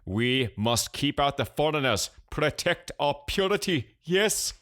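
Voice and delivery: cartoon Pakistani accent climbing high; thick fake Pakistani accent. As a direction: push the mock Pakistani accent upward to a question. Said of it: "deepening the fake accent".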